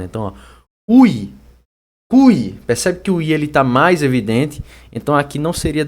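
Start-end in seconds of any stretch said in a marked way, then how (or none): 0.9-2.5 "ui, cui" punched high and loud on the I; loud, high, falling "ui", then "cui"; stressed vowel. Shown as a demonstration. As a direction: lean hard on the I in "cui". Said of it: "som um pouco mais acentuado".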